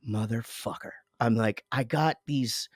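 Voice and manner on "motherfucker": under-the-breath curse, much softer; muttered curse. Retold or imitated. Dad frustrated at son's complaint, muttering quietly. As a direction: swear quietly to yourself.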